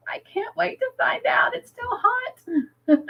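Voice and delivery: very high, squeaky-pitched impatience; mock-desperate, high-pitched whine ending toward a laugh; playful high whine. Real, not acted. Playful complaint about hot tea, then laughs.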